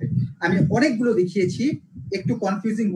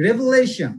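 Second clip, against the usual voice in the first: Revelation with লে punched, falling after; stress before shon; 3 dB louder, much slower than usual. Shown as a demonstration. Teacher drilling the shon rule, revelation stressed on লে. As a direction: hit লে in revelation. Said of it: "শন এর আগের যে সিলেবলটা".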